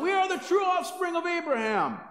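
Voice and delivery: a hammed-up Pharisee cry; mock shout. Pharisees heckling Jesus on the show, mild mock shout. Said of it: "start shouting out".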